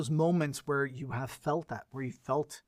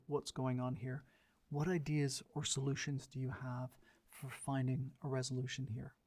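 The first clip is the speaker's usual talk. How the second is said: softer, calm reassuring voice; warm calm tone; 6 dB quieter than usual. Shown as a demonstration. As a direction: bring it down soft to calm an angry man. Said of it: "bring my tone down to a warmer place".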